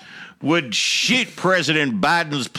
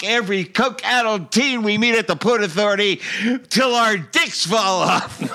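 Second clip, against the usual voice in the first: triumphant mock Trump declaration; 6 semitones higher than usual. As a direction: declare it like a grand victory speech, cracking into a laugh at the end. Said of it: "triumphantly declaring at the top of his voice".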